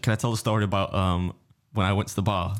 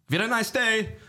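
retold shout; 12 semitones higher than usual. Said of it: "he did actually shout as well".

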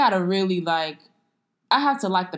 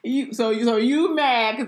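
laughing through the repeat; laughing tease; 2 semitones higher, 3 dB louder than usual. Real, not acted. Host repeating the tease to her co-host, laughing. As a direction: still laughing, pressing the tease.

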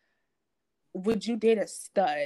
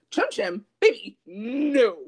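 incredulous scoff; 6 dB louder, slower than usual. Retold or imitated. Reacting to guys' trimming.

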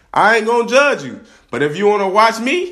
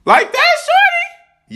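squeaky high tease; 4 dB louder, much slower than usual. Pretend. Answering imagined impressed fans, in a playful high falsetto. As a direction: leap up into a high falsetto, playful and much louder.

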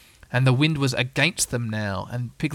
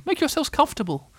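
very high owl host; kindly Owl; 14 semitones higher than usual. A narrator's reading. Owl settles his visitors, high kindly voice. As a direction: fuss over guests in a high voice. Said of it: "said Owl kindly".

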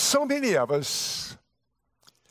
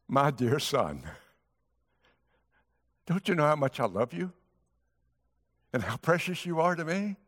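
tender father. Acted. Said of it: "the most tender word that can be found".

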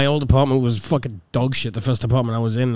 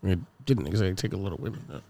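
low, very soft, near-whisper; conspiratorial hush; 7 semitones lower, 13 dB quieter than usual. Pretend. Friend confiding gossip about Ryan on a podcast, hushed. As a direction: murmur it as if people are listening. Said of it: "you guys are whispering".

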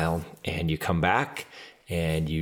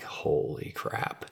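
slow, low, voiceless murmur; hushed dread; 4 semitones lower, 7 dB quieter, much slower than usual. Retold or imitated. Team hearing the burpee penalty, hushed dread. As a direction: drag it out under your breath.

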